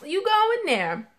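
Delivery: high squeaky laughing okay; laughing high disbelief. Retold or imitated. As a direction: laugh it off, pitch way up. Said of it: "and I was just like".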